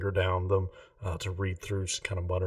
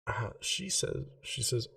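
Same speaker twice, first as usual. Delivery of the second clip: normal talking voice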